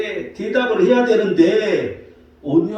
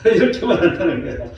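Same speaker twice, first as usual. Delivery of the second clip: usual speaking voice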